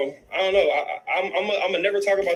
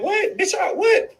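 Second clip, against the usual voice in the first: a sudden, high, loud cry of disbelief; outraged shout; 13 semitones higher, 3 dB louder, much slower than usual. Real, not acted. Recalling the threat to his daughter, he erupts in a shout. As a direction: burst out in indignant disbelief.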